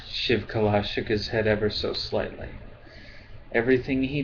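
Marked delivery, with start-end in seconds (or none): none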